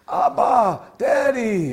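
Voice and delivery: Abba, Daddy cried out high and loud; joyful cry. Acted. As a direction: call out Daddy with bursting joy. Preacher voices joyful cry to God.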